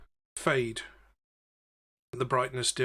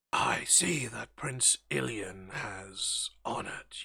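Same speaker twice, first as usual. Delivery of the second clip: slow eerie whispered guard voice; solemn undead whisper, drawn out; whispering skeleton; 3 semitones lower, slower than usual. Acted.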